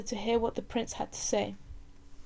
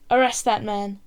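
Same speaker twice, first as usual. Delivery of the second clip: louder commanding order; barked order; 8 dB louder than usual. Acted. Chief advisor commanding the guards, a loud order. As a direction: order the guards to seize him.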